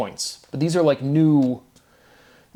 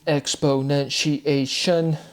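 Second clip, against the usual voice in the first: "exponentiation" very slow, each syllable separated; emphasized word form; 2 semitones higher, much slower than usual. Shown as a demonstration. Speaker showing syllable count to viewers, "exponentiation" slowly broken up. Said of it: "emphasizing the word forms".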